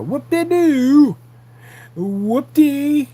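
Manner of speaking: high, loud, drawn-out mock cheer; sarcastic whooping. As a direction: stretch it out in exaggerated fake celebration. Sarcastic dismissal of the audience.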